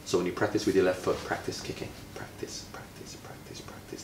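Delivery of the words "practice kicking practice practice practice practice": study, study whispered, fading away; whispered repetition. Shown as a demonstration. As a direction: whisper the drill softer and softer. Repetition drilled for a child.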